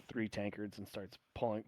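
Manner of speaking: normal talking voice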